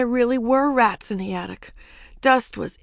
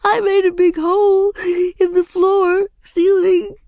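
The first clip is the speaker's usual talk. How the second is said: high, loud tearful wail with sobbing breaths; weeping; 12 semitones higher, 8 dB louder than usual. A narrator's reading. Ramona confessing the hole, loud weeping wail. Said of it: "wept Ramona".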